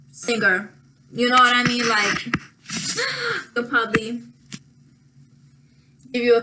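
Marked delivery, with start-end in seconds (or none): 2.7-3.5 a quick, sharp, high gasp. Real, not acted. Talking about her singing, a sudden high gasp. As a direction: suck in a startled breath. Catching herself mid-sentence.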